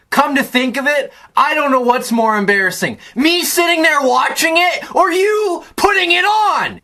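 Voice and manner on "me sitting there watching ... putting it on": high-pitched indignant rant; worked-up fan yelling at the team; exasperated rant. Real, not acted. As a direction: raise the pitch as the outrage builds.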